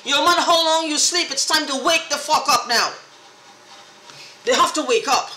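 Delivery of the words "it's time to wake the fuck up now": normal talking voice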